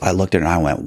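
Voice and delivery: usual speaking voice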